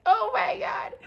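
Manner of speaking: high tearful squeal; crying laugh. Retold or imitated. Daughter answering her mother, crying with laughter. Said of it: "we're just crying laugh".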